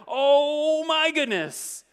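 high, loud, slow panicked cry; hammed-up shriek of alarm; mock panic. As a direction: ham up the terrified townsfolk. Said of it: "They were like".

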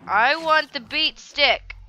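slow, high, loud insistence; loud demand. Acted. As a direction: stretch out a noisy demand. Demands an item in game.

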